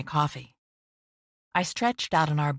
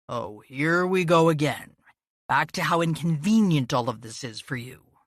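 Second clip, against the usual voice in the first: sarcastic. A narrator's reading. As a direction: scoff at her. Scowling sarcastic retort.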